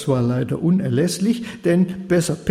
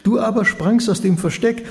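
ordinary delivery, nothing marked

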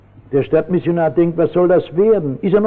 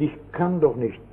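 shaken; 3 semitones lower, 7 dB quieter than usual. Retold or imitated. Preacher quietly repeating the drunkard's despairing words. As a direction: echo it quietly, softer. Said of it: "Erschütternd".

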